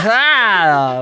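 piercing high laugh into a yelp; painfully loud shriek laugh. Real, not acted. Shouts, hurts own ear.

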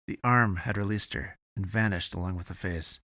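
usual speaking voice

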